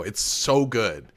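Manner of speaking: normal talking voice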